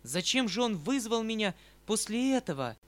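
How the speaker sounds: громко, с досадой; выплеснуть раздражение голосом; вскрик досады. Acted. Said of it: "вскричала я в припадке нестерпимой досады". (громкий досадливый возглас)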